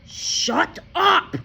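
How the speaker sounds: loud, high furious yell; shouted. A narrator's reading. Father shouts down Matilda. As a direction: cut her off with a yell. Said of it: "the father shouted".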